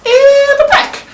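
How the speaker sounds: loud high squeak of pain; high pained yelp. Real, not acted. Nose pain outburst.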